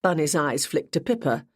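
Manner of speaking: ordinary delivery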